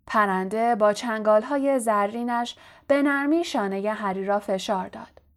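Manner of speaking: plain reading voice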